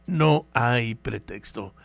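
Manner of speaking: ordinary talk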